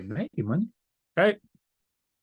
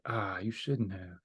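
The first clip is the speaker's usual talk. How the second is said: mock modesty; 4 semitones lower, 11 dB quieter, slower than usual. Pretends to receive a gift.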